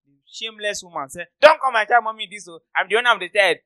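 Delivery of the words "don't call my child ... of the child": louder mock protest; indignant mother. Mother defending her pregnant child, indignant. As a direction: act out a mother objecting.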